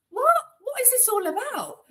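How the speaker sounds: high, baffled mock question; baffled disbelief. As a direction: act stunned at the question. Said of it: "I was like".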